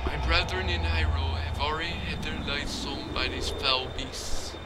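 soft spirit voice. Acted.

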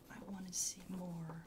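hushed aside to a colleague; whispered verdict to the other interviewer. Real, not acted. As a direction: whisper it so the candidate won't hear.

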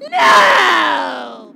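very loud despairing yell; despairing shout. Acted. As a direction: scream it out in despair. Biggest dismay, shouted.

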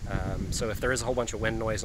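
ordinary talk

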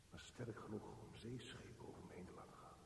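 soft unvoiced whisper. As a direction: breathe it to yourself. Inner monologue, whispered.

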